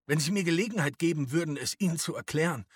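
high, loud, hurried protest; pleading father. A narrator's reading. Papa trying to reason with the SA man, hurried urgent pleading. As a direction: plead urgently for a chance to speak.

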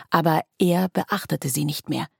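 ordinary delivery

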